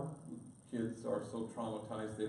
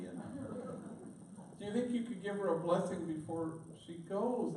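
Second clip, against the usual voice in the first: imitated worried father, higher pitch throughout; anxious pleading; 8 semitones higher than usual. Dad worried about his daughter leaving for college, anxious higher plea. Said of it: "with panic in his voice".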